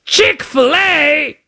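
hammed-up British delight, slow and high; bad British accent. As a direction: savour the name like a thrilled British tourist. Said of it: "My accents are awful".

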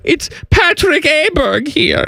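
high-pitched mock German accent; comic high German accent; Patrick Aberg. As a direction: introduce yourself in a hammed-up high German voice. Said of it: "I think all Germans sound".

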